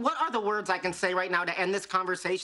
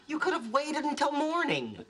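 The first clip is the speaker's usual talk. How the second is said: exasperated; 7 semitones higher than usual. Acted. Roommate exasperated at night-time visit.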